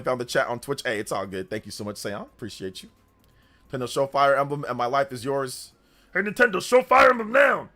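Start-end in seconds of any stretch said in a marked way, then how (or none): 6.1-7.7 hammed-up authority. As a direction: bellow it as a playful threat. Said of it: "you gotta say it with authority".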